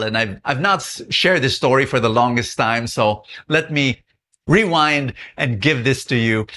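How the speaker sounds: ordinary talk, nothing marked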